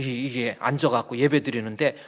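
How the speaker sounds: ordinary talk, nothing marked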